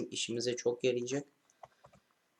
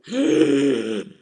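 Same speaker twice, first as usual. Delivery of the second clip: sharp loud startled gasp; 14 dB louder than usual. Real, not acted. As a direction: gasp hard as if caught off guard. Sudden alarm in game.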